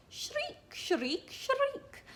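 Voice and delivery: "Shriek" with a trilled rolled R, squealed very high. Shown as a demonstration. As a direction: say "Shriek" rolling the R, high like a peacock. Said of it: "and really roll my R's".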